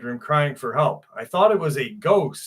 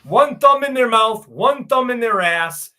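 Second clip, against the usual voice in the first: mocking impression; 7 semitones higher, 6 dB louder than usual. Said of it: "They're like this".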